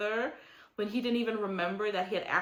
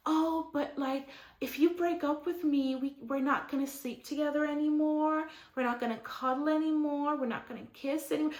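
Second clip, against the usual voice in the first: mock pleading girlfriend; 6 semitones higher than usual. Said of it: "She's like".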